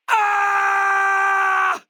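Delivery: high, loud cry of pain; sudden yell of pain; pained shout. Acted. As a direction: shout as if struck hard. Taking a heavy hit.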